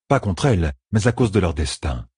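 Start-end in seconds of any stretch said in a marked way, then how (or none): none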